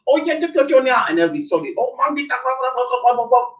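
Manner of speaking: normal talking voice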